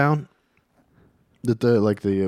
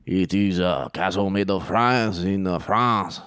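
put-on French accent, lower pitch; 4 semitones lower than usual.

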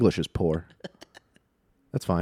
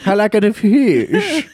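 a hint of a North Korean accent, goofing on a jet ski; Kim Jong-un impression. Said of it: "Kim Jong-un on a jet ski".

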